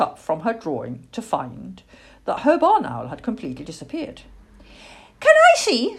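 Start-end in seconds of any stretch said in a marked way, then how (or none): 5.2-6.0 high, eager little pipe; squeaky small voice, pitched way up; small owlet voice. A narrator's reading. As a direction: ask like a tiny impatient child. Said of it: "said a small voice".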